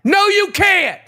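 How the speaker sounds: high-pitched argumentative yell; angry shout. Real, not acted. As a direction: shut them down hard. Shouting down the co-host's request.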